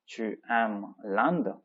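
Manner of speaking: slow, word-by-word model reading; each word drawn out and separated; slow repetition. Shown as a demonstration. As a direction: repeat it slowly for language learners. Teacher repeats the line slowly for learners.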